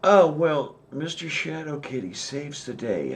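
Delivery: ordinary talk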